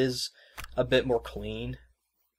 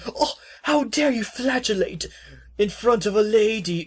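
prim offended lady; 10 semitones higher, 5 dB louder than usual. Retold or imitated. Host imagining Laxia scolding Sahad, mock prim. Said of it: "aren't you gonna be like".

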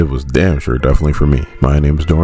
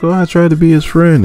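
put-on high, pleading girl voice; mock whiny Supergirl, pitched high; defensive Kara; 14 semitones higher, 3 dB louder than usual. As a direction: plead your innocence like a scolded kid.

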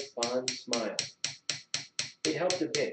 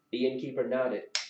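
ordinary delivery, nothing marked

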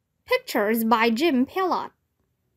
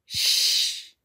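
long, voiceless ghostly shush. A narrator's reading. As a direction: hush the shouting child. Ghosts shushing the shouting child.

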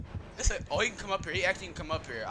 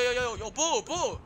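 ordinary talk

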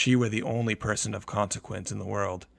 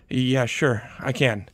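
anxious; 4 semitones higher, 6 dB louder, slower than usual. A narrator's reading. Panicked mother on phone.

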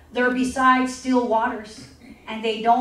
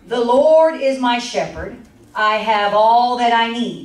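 slow, solemn, louder scripture read; 6 dB louder than usual. Acted. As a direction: read it out slowly and reverently.

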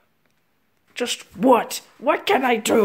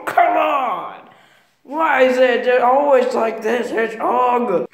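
ordinary talk, nothing marked

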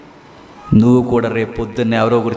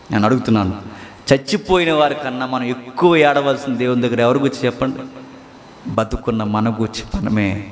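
ordinary delivery

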